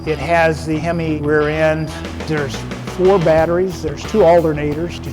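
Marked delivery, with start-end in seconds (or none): none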